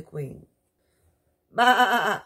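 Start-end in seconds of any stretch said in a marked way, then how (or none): 1.5-2.2 loud, high bleating "Bah"; goat bleat. Acted. Goat bleating at the king and queen. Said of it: "said the goat".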